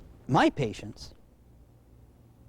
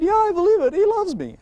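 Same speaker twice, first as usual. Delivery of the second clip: put-on little child's voice; 13 semitones higher, 5 dB louder than usual. Child voiced in retold story.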